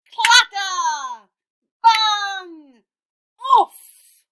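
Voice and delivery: very loud, high impact words; booming sound effects. Acted. Narrator booms the noise of the clash, very loud. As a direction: punch each crash word.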